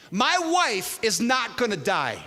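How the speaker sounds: slow, high, emphatic defiance; defiant declaration. Retold or imitated. Husband speaking to the beast, slow defiant declaration. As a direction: hurl each word slowly at the enemy. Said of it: "I'm saying to the beast".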